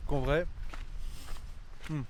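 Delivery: talking with a mouth full of food; words round a big bite. Real, not acted. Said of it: "je parle la bouche pleine". (la bouche pleine)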